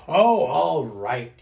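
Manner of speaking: slow, loud, sighing give-in; grudging sigh. Acted. Smelly Bear gives in grudgingly, sighing. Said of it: "sighed a big sigh".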